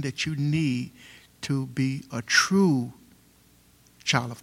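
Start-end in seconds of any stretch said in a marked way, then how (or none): 1.4-2.9 "true" drawn out slowly and leaned on; slow right down and put the weight on "true"; deliberate, weighty emphasis on "true"; stress on TRUE. Real, not acted. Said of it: "Emphasis on the word true".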